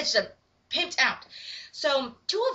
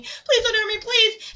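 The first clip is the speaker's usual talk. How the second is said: higher, pleading cry; retold terrified plea, moderately raised; mock screaming plea; 8 semitones higher, 4 dB louder, slower than usual. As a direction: beg for mercy in acted fright. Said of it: "She's screaming".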